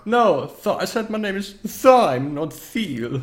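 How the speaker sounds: high, loud, swooping correction; old necromancer. Acted. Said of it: "every time you talk as this necromancer".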